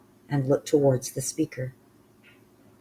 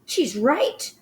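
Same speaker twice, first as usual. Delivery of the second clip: high, raised agreement; crying out; 11 semitones higher, 5 dB louder than usual. Acted. Another witch backs Mildred, crying out high. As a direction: cry it out in agreement. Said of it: "cried another one".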